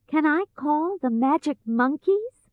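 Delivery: put-on high, questioning girl's voice; young Dorothy. A narrator's reading. Dorothy wonders about magic monkeys, questioning. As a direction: ask it wonderingly, pitched high.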